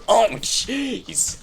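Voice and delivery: panicked high yelps; frantic oh my god, rising in panic; scared horror game streamer. Real, not acted. Attacked by unseen thing.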